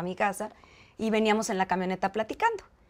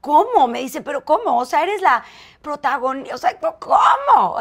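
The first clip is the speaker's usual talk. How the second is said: shocked disbelief; 6 semitones higher, 8 dB louder than usual. Retold or imitated. Manuel's shocked reaction retold.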